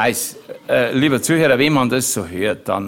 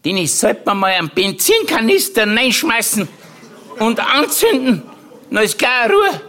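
put-on much higher, squeaky old-aunt voice with a laugh; Tante Mari nachgemacht; 10 semitones higher than usual. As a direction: ham up the scheming old aunt. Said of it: "Da hat sie gesagt, die Tante Mari". (viel höhere, verstellte Tantenstimme)

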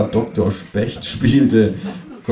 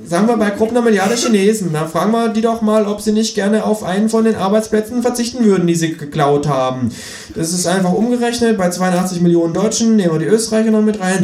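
high, scheming kangaroo voice; 8 semitones higher than usual. Acted. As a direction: make the pitch in a high voice. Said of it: "sagt das Känguru".

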